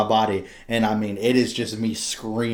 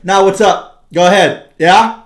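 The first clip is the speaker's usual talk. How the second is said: higher and louder, deliberately unhushed; loud casual reply; 7 semitones higher, 11 dB louder, slower than usual. Retold or imitated. Parent answering nurse loudly beside baby. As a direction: answer at full volume on purpose. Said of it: "I'd be like".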